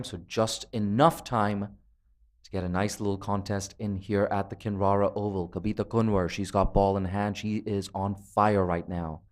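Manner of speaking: ordinary delivery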